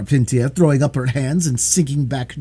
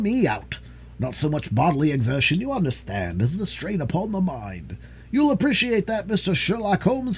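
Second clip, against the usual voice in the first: a higher-pitched boastful detective, lightly acted; hint of a man pleased with his own labors; lightly voiced self-important detective; 9 semitones higher than usual. Detective flattering himself before Holmes, mildly self-important.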